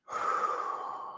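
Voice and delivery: soft, audible breath out; relaxing exhale. Shown as a demonstration. Teacher showing the release, sighing. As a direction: let it all go. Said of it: "relax the arms".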